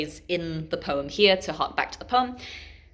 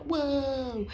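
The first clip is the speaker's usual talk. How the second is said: higher, drawn-out mock awe; awed whoa; 7 semitones higher, 4 dB quieter than usual. Kids watch a perfect high dive, imitated awe. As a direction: imitate an impressed kid.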